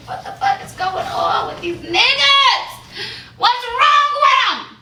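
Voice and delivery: high, loud yell of disbelief; shrieked comic exasperation; exasperated shout. Real, not acted. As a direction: cry out in exasperation, pitch soaring. Exasperated outburst to the audience.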